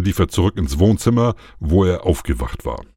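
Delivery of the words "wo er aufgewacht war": ordinary delivery, nothing marked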